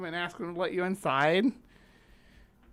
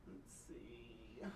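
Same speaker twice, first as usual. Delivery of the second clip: breathy whispered aside; 9 semitones lower, 24 dB quieter than usual. Real, not acted. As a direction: whisper it to yourself. Muttering search to herself.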